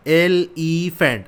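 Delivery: "Elephant" drawn out slowly in three separate parts; slow teacher's breakdown of "elephant"; syllable by syllable. Shown as a demonstration. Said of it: "थोड़ा सा हम break दे के पढ़ें".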